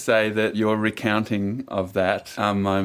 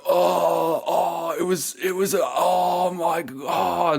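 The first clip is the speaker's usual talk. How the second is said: higher, slowed, gasping oh my God; mock tongue-tied surfer; 8 semitones higher, slower than usual. Host mocking surfers who only grunt, higher awed stammer. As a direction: stammer like a stoked surfer lost for words. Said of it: "we just grunt and make weird sounds".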